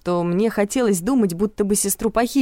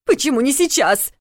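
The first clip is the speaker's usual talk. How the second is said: higher, outraged demand; indignant; 7 semitones higher than usual. A narrator's reading. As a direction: protest sharply and high. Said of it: "возмутилась мама".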